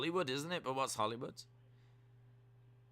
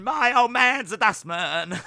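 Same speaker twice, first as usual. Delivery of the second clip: loud, higher Cockney British take; British accent; 10 semitones higher, 11 dB louder than usual. Acted. Second British accent take, loud and higher Cockney. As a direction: repeat it brighter in Cockney British. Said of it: "Do a British accent".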